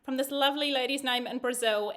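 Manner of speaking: usual speaking voice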